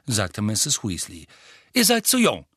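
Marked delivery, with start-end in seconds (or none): 1.7-2.5 high, loud, stern ruling; stern mother. A narrator's reading. Mother telling children they are too young, stern. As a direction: shut the youngsters down.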